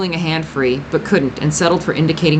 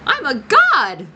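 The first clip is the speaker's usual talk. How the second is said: delighted; 10 semitones higher, slower than usual. A narrator's reading. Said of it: "the boy said, delighted".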